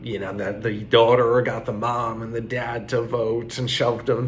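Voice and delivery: usual speaking voice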